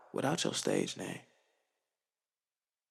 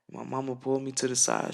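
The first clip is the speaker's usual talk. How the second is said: usual speaking voice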